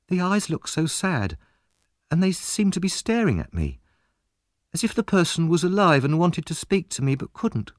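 put-on a boy unsettled by a sad portrait; wondering boy. A narrator's reading.

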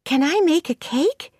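put-on high, eager girl's question; excited. A narrator's reading. Anne begs Marilla to let her bake, excitedly. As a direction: ask excitedly, pitched right up. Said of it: "said Anne excitedly".

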